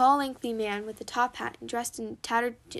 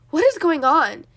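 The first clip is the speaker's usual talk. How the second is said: loud high yell; 5 semitones higher, 14 dB louder than usual. Acted. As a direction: shout it, alarmed. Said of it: "yelled James".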